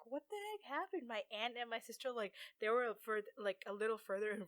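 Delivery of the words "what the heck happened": high-pitched retold alarm; freaked out. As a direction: imitate startled disbelief, pitched up. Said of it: "I freaked out too".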